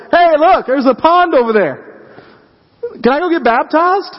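put-on like a kid who just spotted a pond; eager eunuch. The eunuch begging Philip to baptize him, high and eager.

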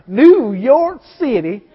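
slow Southern "New York City", drawn out. Shown as a demonstration. Preacher mocking his own Southern accent, slow Southern drawl. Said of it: "I sound like that 'cause I'm just from the South".

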